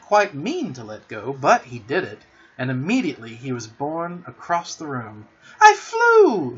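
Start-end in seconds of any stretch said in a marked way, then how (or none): 5.6-6.6 very high, loud squeal of triumph; excited scream. A narrator's reading. Michael flies across the room, triumphant scream. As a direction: scream it out in sheer delight. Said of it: "he screamed".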